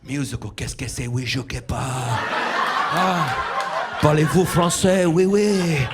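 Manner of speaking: hammed-up fake French accent, rising to a shout; mock French. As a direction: play a cartoon Frenchman. Mock French dirty talk.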